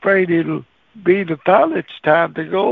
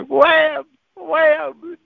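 high-pitched shouted slam noise; comic high "wham"; door slam; 10 semitones higher than usual. Retold or imitated. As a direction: imitate a door banging shut, high and sudden. Imitates door slamming in face.

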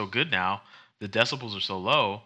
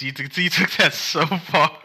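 louder, higher words through laughter; laughing while talking; 7 semitones higher, 6 dB louder than usual. Real, not acted. Host laughing at his co-host's wild idea. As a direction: laugh out the scolding.

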